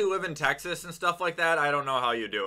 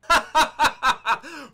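gleeful laugh; 11 semitones higher, 7 dB louder than usual. Real, not acted. Gleeful laugh over own trick.

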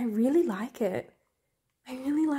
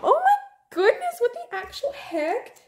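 stunned; 12 semitones higher than usual. Real, not acted. Fan surprised while sorting the set, stunned squeal. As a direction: burst out in disbelief.

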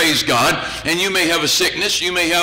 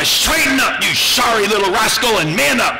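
stern parent shouting; 4 semitones higher than usual. Acted. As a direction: yell at the boy to shape up. Parent orders lazy boy.

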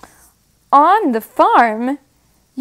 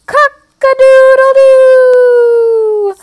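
rooster crow; 13 semitones higher, 8 dB louder than usual. Pretend. Rooster sounds the alarm.

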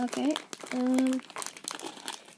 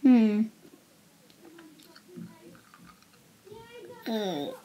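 chewing while grimacing; muffled hum through candy; mouth full, chewing; 4 semitones higher than usual. Real, not acted. Seen 0: Holds candy wrapper; lips pressed, jaw working, disgusted grimace.

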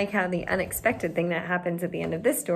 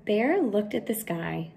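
ordinary delivery, nothing marked

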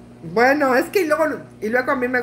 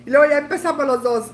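ordinary delivery

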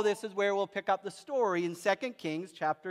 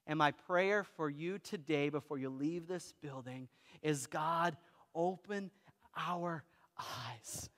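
soft prayer; 4 semitones lower, 4 dB quieter than usual. Acted. Pastor praying over his congregation, softly. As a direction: pray gently over the room.

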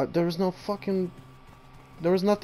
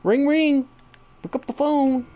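mock call to the monster; 6 semitones higher, 5 dB louder than usual. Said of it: "Do you say".

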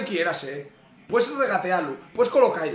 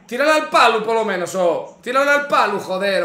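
louder, exasperated plea to the striker; frustrated yell at the screen; 5 dB louder than usual. Real, not acted. Fan pleading with the striker on screen, frustrated yell. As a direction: shout it like the shot just went wide. Yelling at a missed chance.

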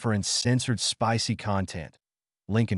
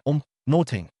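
sputtered; 4 semitones higher than usual. A narrator's reading. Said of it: "The goth girl sputtered".

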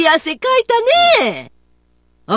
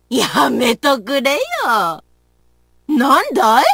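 ordinary talk

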